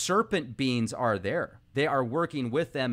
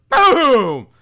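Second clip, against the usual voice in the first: the word boom bursts out much louder; sudden loud boom; 9 semitones higher, 14 dB louder than usual. Acted. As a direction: build up to a loud explosive boom. Dramatic climax of monkey story.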